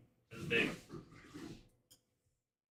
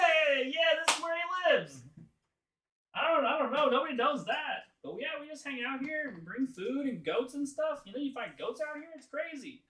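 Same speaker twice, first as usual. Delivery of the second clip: high little-monster voice; charmed creature, chatty; 12 semitones higher than usual. Acted. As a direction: babble happily to your new best friend.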